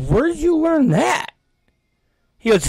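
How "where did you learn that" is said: much higher, startled question; sudden jump up in pitch, baffled; incredulous high-pitched where did you learn that; surprised dad. Retold or imitated. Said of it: "I said".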